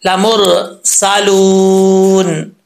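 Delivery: लामुर saloon drawn out syllable by syllable; slow recitation. Shown as a demonstration. Teacher reciting the verse slowly to students. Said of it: "आगे है".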